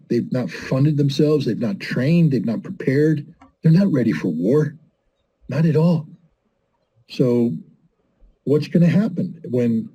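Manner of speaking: ordinary talk, nothing marked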